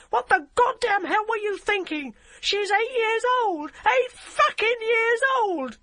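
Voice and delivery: very high, very loud shriek slowing on each word; furious shriek. A narrator's reading. Barbara screams at the DCI about the child, very high loud shriek. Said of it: "she shrieked".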